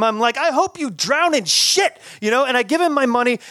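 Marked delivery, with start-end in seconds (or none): 0.4-2.0 cursing. Retold or imitated. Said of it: "all I could do was just curse at him".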